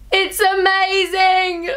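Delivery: high, very drawn-out delight; thrilled gushing. Real, not acted. Vlogger raving about the glow, high thrilled gush. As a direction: stretch every word in high-pitched amazement.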